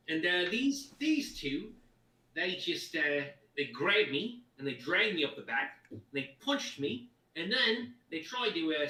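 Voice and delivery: thick Cockney street voice; Cockney accent. Acted. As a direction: play a cockney ruffian. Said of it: "this is too cockney".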